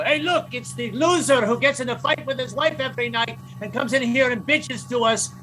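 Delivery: high-pitched imitated Greek greeting; put-on Greek voice, stretched last word; mock Greek restaurateur. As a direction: announce him like a Greek host with a long name. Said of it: "they go".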